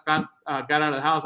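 normal talking voice

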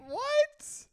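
high, loud shocked yelp; shocked what. Real, not acted. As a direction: react in total disbelief. Shocked reply to news.

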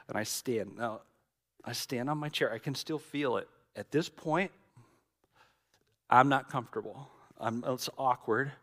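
ordinary talk, nothing marked

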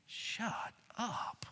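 very soft, voiceless whisper; hushed disbelief. Acted. Peter answering Jesus's news of death, whispered. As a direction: whisper it, word by word. Peter's stunned reply, mouthed softly.

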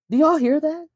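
high, incredulous call to the crowd; disbelieving question to the audience; amazed. Real, not acted. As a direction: make them hear how absurd it is. Stunned by the lyric.

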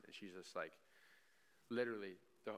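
ordinary talk, nothing marked

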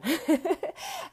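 bright laugh bursting out; burst of laughter. Real, not acted. Host laughing at her own point, bright laugh. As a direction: laugh at your own joke.

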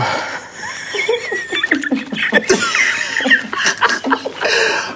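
silent high wheeze of laughter; helpless wheezing laugh. Real, not acted. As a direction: crack up until no sound comes out. Breaks down in laughter.

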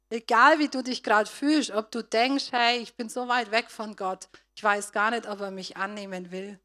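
loud, urgent sermon appeal; rising preacher's plea; fervent preaching. Acted. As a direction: press the listeners to turn back.

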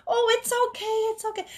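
high, reassuring grandmother voice; very high, soothing old lady. Retold or imitated. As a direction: soothe like a kindly neighbor's grandma. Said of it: "the lady just".

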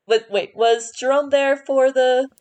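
ordinary delivery

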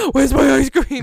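put-on loud, plaintive kid call; loud kid demand. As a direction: call out for your ice cream from inside the cooler. Kid head in ice cream cooler.

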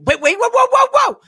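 high, loud call to stop them, climbing; mock panicked shout. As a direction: yell after the departing crew. Panicked calling after workers.

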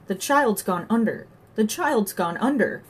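horrified cry. A narrator's reading. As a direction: repeat it in mounting alarm. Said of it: "cried Johnny".

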